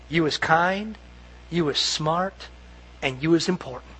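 soft, low, gentle reassurance; tender whisper-like. Retold or imitated. Nanny softly telling the little girl she matters. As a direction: murmur it like a nanny to a child. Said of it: "remember what she whispers to them".

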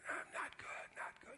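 dying man's faint breathy whisper, barely getting the words out; weak whisper. Retold or imitated. Retelling a dying uncle's faint reply, whispered. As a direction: whisper it like you can hardly speak. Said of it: "He could barely talk".

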